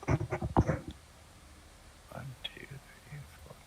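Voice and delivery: under the breath counting; whispered count. Real, not acted.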